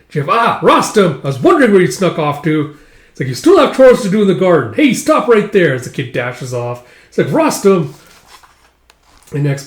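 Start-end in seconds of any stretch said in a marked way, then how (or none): 0.3-5.7 loud, high scolding call; calling out sternly. Acted. Calling out the runaway kid. 7.3-7.9 loud, high yell of a name; calling after. Acted. Yelling after fleeing kid.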